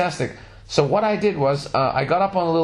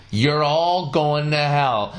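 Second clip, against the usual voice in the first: slow, drawn-out mock verdict; slow deadpan prophecy of hell; slow doom verdict; much slower than usual. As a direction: drag out the damnation for comic effect. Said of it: "looked back at them".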